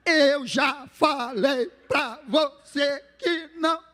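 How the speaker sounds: slow shout, every syllable hammered; angry parent spelling it out in a yell; syllable-by-syllable scolding. Retold or imitated. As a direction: beat each syllable out like blows. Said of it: "vem a surra em sílabas".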